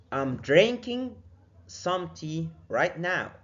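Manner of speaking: slow demo. Shown as a demonstration. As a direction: spell it out slowly. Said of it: "teacher models a sentence". (نطق بطيء للتعليم)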